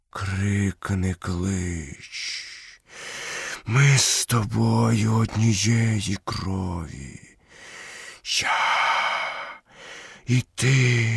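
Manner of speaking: very slow python voice with long pauses; Каа. A narrator's reading. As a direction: draw each word out like a snake. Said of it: "запитав Пітон Каа". (повільний зміїний голос із довгими паузами)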